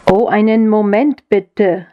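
usual speaking voice

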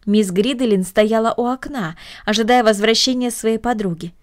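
usual speaking voice

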